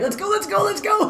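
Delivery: very high, eager hurry-up; impatient excitement. Retold or imitated. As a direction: urge them out the door, giddy. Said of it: "you'll be, like, in the car already".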